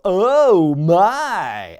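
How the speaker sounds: loud, amazed commentator cry; mock commentator astonishment; Cole's "oh my". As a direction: belt it out like a stunned announcer. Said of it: "we hear Cole go".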